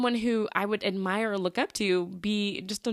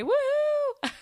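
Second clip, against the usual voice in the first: high squealed woohoo breaking into a laugh; excited cheer; 4 dB louder than usual. Real, not acted. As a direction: cheer for the milestone, then laugh. Celebrating six months of podcasting.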